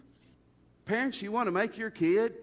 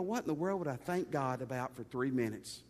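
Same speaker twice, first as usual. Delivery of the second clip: mock doubting listener; 6 semitones lower, 4 dB quieter than usual. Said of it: "Some of you go".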